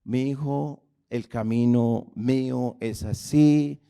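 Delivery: tender voice of the Lord. Retold or imitated. Preacher voicing the Lord lovingly to his child. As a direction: gently teach a son.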